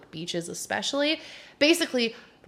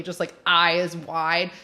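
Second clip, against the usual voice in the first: usual speaking voice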